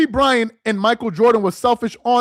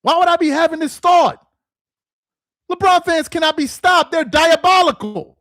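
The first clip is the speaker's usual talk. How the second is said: exasperated shout; 6 semitones higher than usual. Real, not acted. Ranting against LeBron fans.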